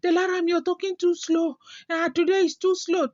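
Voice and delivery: high mock complaint; playful high nagging; imagined viewer. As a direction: needle her like a picky fan. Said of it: "somebody will say".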